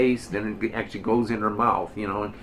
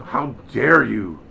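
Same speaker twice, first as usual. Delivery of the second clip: mock indignant outburst, slow and louder; indignant attitude; 5 dB louder, slower than usual. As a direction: act out their affronted challenge, drawn out. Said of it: "took the attitude of".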